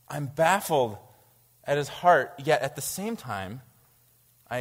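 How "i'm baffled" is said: usual speaking voice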